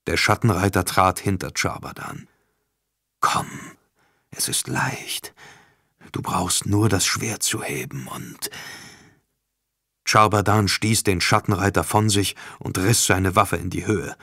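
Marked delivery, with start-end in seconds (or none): 3.2-8.5 slow, soft and tempting; seductive villain whisper. Acted. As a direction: coax like a tempter. Demon coaxes Charbadan to kill. (verführerisches Flüstern des Bösewichts)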